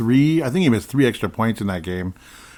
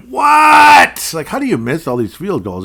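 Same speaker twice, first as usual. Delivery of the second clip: incredulous shout, much higher and louder; yelling out; 10 semitones higher, 11 dB louder, slower than usual. Retold or imitated. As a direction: yell it at the TV in disbelief. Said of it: "just yelling out".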